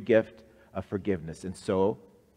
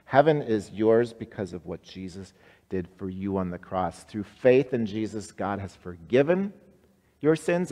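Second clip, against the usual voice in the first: ordinary talk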